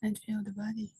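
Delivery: soft, unhurried meditation guidance with long pauses; guided meditation. Real, not acted. Teacher guides seated group to relax the body, soft meditation voice. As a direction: settle them gently, leaving long silences between phrases.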